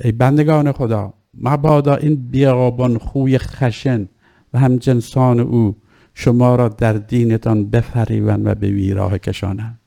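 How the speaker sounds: ordinary talk, nothing marked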